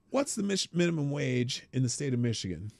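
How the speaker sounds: usual speaking voice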